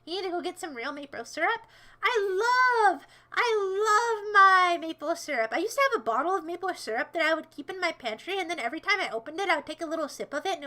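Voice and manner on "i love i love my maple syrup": high, loud, drawn-out adoration; gushing love. Real, not acted. Streamer professing love of maple syrup, gushing. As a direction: stretch it out and swoon over it.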